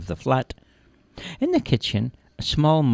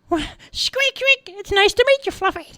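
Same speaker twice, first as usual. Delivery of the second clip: very high squeaky voice; high-pitched squeaking hello; friendly little mouse. Acted. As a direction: greet warmly as a cartoon mouse. Mouse greets cat.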